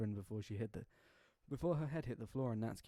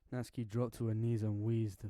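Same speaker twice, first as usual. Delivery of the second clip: usual speaking voice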